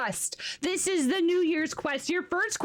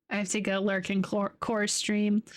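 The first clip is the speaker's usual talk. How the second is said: normal talking voice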